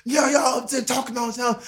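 high-pitched mock shout; imitated calling out. Inmate complaining to the talkers upstairs, imitated shout.

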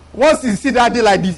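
ordinary talk, nothing marked

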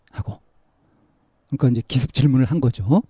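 normal talking voice

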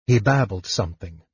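ordinary delivery, nothing marked

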